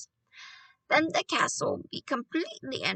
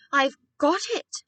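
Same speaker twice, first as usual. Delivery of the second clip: raised, higher exclamation; excited shout; 5 semitones higher, 5 dB louder than usual. A narrator's reading. Boy leaping up with an idea, excited shout. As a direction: call out a sudden idea. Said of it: "I shouted, leaping up".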